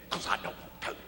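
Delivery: a breathy, sheepish whisper of refusal; reluctant whisper. Retold or imitated. Preacher admitting to God he won't, whispering. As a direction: murmur it like a guilty excuse.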